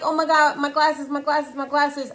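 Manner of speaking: high, frantic repeated cry of dismay; retold panic. Mom hunting for lost glasses, panicking. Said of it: "I'm panicking".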